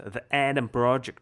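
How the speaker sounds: Adam Project in an American accent. Shown as a demonstration. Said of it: "я там использовал американский акцент".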